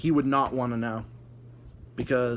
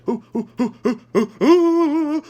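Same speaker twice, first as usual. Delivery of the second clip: high, loud hammed-up fearful whimpers; mock panicked ooh; 11 semitones higher, 7 dB louder than usual. Imagined prisoner waiting for a bomb, hammed-up panicked whimpering.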